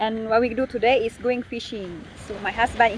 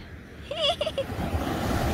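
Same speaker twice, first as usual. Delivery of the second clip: happy laugh; 5 dB quieter than usual. Real, not acted. Happy laugh over fish.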